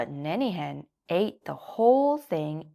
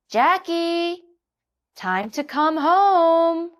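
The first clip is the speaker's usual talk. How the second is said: high, louder, slow call home; 12 semitones higher, 8 dB louder, slower than usual. A narrator's reading. Jackie's mama calls him home. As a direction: call a child in. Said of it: "shouted Jackie's mama".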